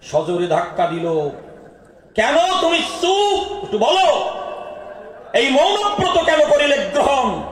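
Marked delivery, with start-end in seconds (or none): 2.1-7.4 pressing shout. Acted. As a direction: shout at him to break his silence. Mandodari presses silent Ravana. (উঁচু গলায় চিৎকার করে চাপ দেওয়া)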